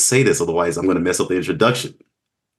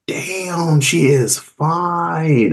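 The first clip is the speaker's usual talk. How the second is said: higher, long drawn-out admiring words; slow, lingering wow; retold admiration; 7 semitones higher, much slower than usual. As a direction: stretch it out in mock-swooning appreciation. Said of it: "and I was like".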